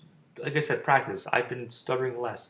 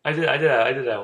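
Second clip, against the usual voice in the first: loud; 10 dB louder than usual. Real, not acted. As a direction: burst out loudly. Sudden loud outburst.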